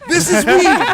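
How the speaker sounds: high, loud, laughing panic of a first-timer in over his head; panicky newbie. Retold or imitated. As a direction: blurt it out as the dab hits you. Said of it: "Just panic attacking".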